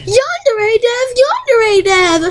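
high, slow called-out name as a fan character; calling Yandere Dev. Retold or imitated. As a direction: call out high and drawn out. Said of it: "Okay, Nixon".

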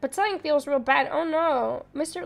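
ordinary talk, nothing marked

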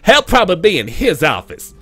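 high sassy read of the line; with attitude. Acted. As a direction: say it with valley girl attitude. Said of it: "She's saying that with attitude".

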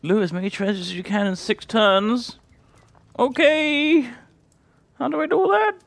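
very high, loud squeaky put-on voice; silly accent voice. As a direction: squeal it in a hammed-up falsetto. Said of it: "that was the worst accent ever".

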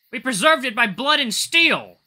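high, loud, slow shout. Acted. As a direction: shout it defiantly. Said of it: "She shouts out".

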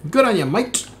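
over-the-top Aussie "good on ya, mate"; hammed-up Aussie accent. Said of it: "the extent of my Aussie accent".

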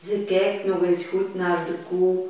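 ordinary delivery, nothing marked

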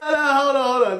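half-laughing hold on. Real, not acted. Laughing at the haters, begging a moment to recover. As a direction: catch your breath through laughs. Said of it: "give me a second".

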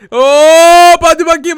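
high loud heave; loud excited shout. Acted. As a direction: yell it while throwing. Throwing the baby.

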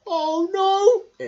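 very high, loud squeal; cartoon alarm. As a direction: squeal while falling. Bear falls toward pond.

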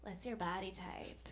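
breathy threatening whisper; menacing whisper. Retold or imitated. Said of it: "articles that are like".

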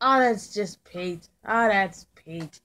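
normal talking voice

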